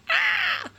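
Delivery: very high, loud exasperated shriek; frustrated shriek. Retold or imitated. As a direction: let out a sudden squeal of frustration. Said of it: "My educational background brain is going".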